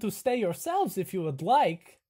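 high, loud, welcoming host; over-eager character voice; eager camp host. Acted. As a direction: invite them in with gusto.

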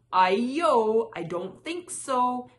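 loud, defiant storybook cry. A narrator's reading. Runaway rice cake refuses the hungry family, crying out. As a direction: call it out big, drawing out the opening. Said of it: "It cried".

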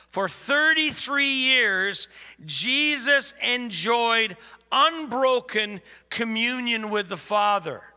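high, loud, slow declamation; emphatic preacher quoting a book; preached reading. Real, not acted. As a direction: read it out grandly, stressing each phrase. Reads quote in preaching voice.

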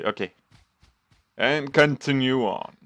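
normal talking voice

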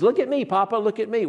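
put-on high, eager child's voice; a little grandkid begging Papa to watch; grandkid showing off. Grandkid calling to Papa, high and eager. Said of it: "and they say".

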